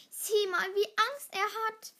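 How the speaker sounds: high, loud jeer, no real laughter; mocking laugh. A narrator's reading. Wirbelwind jeers at frightened Schneeball, mocking. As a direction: point him out and make fun. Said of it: "lachte Wirbelwind".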